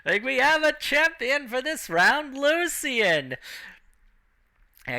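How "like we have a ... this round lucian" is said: high-pitched winner announcement; ring announcer. Acted. Announcer naming Lucian champion for this round, high proclaiming call. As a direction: call out the champion to the whole arena.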